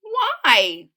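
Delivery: put-on high, loud little-girl question; acted child's protest. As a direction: ask it insistently as a curious child. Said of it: "Ada questioned".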